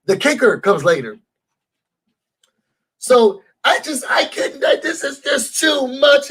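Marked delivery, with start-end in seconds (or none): 3.0-6.3 high, breathless laughter mixed with crying; can't get words out for laughing; laughing helplessly. Real, not acted. Telling viewers she can't cope, laughing helplessly.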